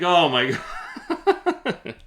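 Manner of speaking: slow, drawn out through a laugh; laughing disbelief. Real, not acted. Reacting to the seller's admission, laughing slowly. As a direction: stretch it out laughing.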